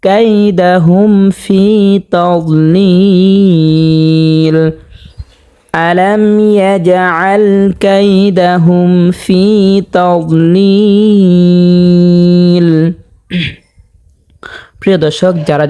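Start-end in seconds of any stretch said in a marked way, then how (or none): 0.0-4.7 তাদলিল drawn out long in a slow chant; three-count stretch. Shown as a demonstration. Teacher demonstrating the long vowel, slow chant. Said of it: "তিন আলিফ পরিমাণ টানিয়ে পড়তে হবে". 5.7-12.9 slow, drawn-out melodic chant; Quran recitation. Shown as a demonstration. Teacher reciting the verse for viewers, slow melodic chant.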